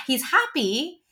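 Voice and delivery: ordinary talk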